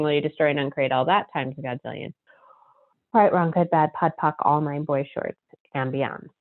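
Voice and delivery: fast, soft, murmured recitation; rapid clearing statement. Acted. Host running the clearing for listeners, a fast, soft, murmured rote recitation. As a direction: rattle the formula off under your breath.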